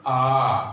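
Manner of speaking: आ said loud with the mouth fully open; wide-open vowel. Shown as a demonstration. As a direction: open right up on a long आ. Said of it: "मुख पूर्ण रूप से खुल जाता है। देखो".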